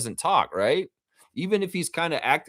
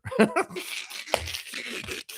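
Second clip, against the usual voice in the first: airy laugh; 6 dB quieter than usual. Real, not acted. Laughing at turtle quote.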